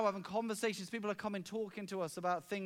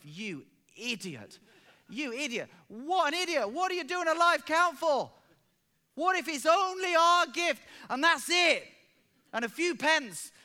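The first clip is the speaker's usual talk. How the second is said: building from muttered to loud exasperated self-scolding; scolding his own foolish plan, rising in pitch; berating himself; 8 semitones higher, 8 dB louder than usual. Retold or imitated. Pastor scolding himself before the live count, exasperated rising rant. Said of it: "I was saying to myself".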